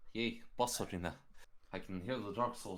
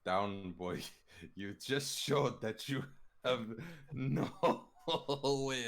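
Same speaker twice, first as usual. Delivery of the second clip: laughing; 6 semitones higher, 6 dB louder than usual. Real, not acted. Player laughing mid-line.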